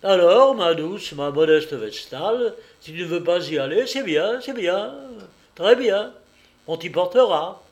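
loud, high-pitched sneering menace; sarcastic bullying; mocking threat. A narrator's reading. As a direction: taunt the girl with mock sweetness, forcefully. Master mocks and threatens slave.

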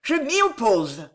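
slow, emphatic cried objection; crying out. A narrator's reading. Woman objecting from afar at a wedding, crying out. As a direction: call out the objection, drawn out. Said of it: "une voix de femme qui s'écria".

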